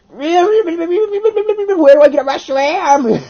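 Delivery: high, drawn-out squealing wail; frantic mock wail. Player desperate to get a Reshiram, frantic mock wail. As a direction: wail it loud and high, stretching the words. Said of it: "And I was like".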